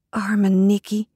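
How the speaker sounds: usual speaking voice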